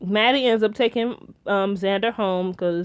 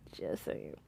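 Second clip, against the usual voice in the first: whispered aside; 21 dB quieter than usual. Real, not acted. Whispered aside after a theory.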